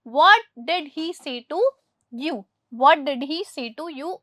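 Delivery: very slow, word by word, for the class; slow dictation. Shown as a demonstration. Teacher reads the English example to pupils very slowly. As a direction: spell out every word for Hindi-speaking pupils. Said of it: "teacher reads the corrected sentence".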